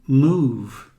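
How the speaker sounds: "Move" with a long drawn u. Shown as a demonstration. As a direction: stretch the vowel in "Move". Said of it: "Este som de U é bem longo".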